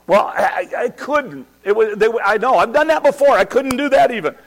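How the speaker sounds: high, loud, stammering defence; flustered protest. Real, not acted. Preacher answering the audience's question, flustered protest. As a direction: protest loudly over the audience.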